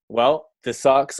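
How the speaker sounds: usual speaking voice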